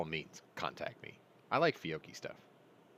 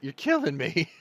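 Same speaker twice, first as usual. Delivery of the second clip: mock exasperated seller; 7 semitones higher, 7 dB louder than usual. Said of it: "He was like".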